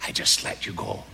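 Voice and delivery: gentle lilting Irish voice, slowed and easing down to a hush; an old Irishman explaining kindly, in a lilting Irish brogue. Retold or imitated. Old Bible teacher answers the young preacher in a lilting Irish voice. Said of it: "in his little Irish lilting voice".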